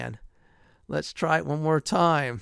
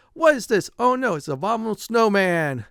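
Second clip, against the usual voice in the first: mock alarm; 6 semitones higher, 5 dB louder than usual. Snowman appears, mock alarm.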